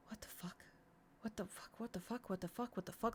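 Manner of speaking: breathless whisper, stunned; hushed, numb repeated whisper; whispered disbelief. Retold or imitated. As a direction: mouth it to yourself, frozen in shock. Frozen inner reaction.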